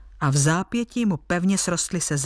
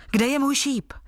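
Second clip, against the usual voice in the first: higher-pitched, mildly impatient question; impatient exclamation; 8 semitones higher than usual. A narrator's reading. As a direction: call it out eagerly, as a storyteller would. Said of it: "zvolal nedočkavě".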